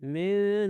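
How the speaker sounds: من with a long humming nasal noon; drawn-out tajweed من; ghunna on noon. Shown as a demonstration. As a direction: hold the n of من through the nose. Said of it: "نون پر غنہ کرنا ہے".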